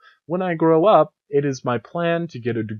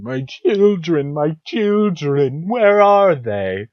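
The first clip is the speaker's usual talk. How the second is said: slow, high, louder wailing cry; weeping ghost wail; 5 semitones higher, 6 dB louder, slower than usual. Acted. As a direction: wail slowly like a mourning ghost. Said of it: "she keeps weeping".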